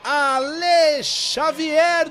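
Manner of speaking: long drawn-out goal scream, high; explosive goal commentary. Real, not acted. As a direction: stretch every syllable of the goal call. Goal scored, long shout. (grito de gol prolongado)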